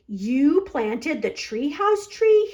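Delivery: loud, slow astonished question; loud, drawn-out amazement; amazed exclaim. A narrator's reading. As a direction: gape up in wonder. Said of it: "he exclaimed".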